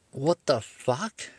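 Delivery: drawn-out baffled. Retold or imitated. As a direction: let the disbelief hang on every word. Baffled at cop's sentence.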